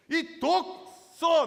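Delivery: loud, high, stretched-out mock curse; imitated angry cursing. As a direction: drag the insult out in an acted shout. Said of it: "막 하면서 막".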